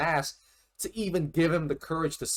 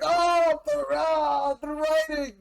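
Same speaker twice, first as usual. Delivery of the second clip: overwhelmed gush; 9 semitones higher than usual. Retold or imitated. Fan overwhelmed by the writing, high excited gush. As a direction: gush over it, swept away. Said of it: "And I was just like".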